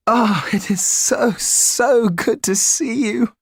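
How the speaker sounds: put-on high, tearful old friend's voice, slow on the welcome; a small man overcome with joy at the reunion; Tetu's voice. Tetu greets Jan through tears, high and moved.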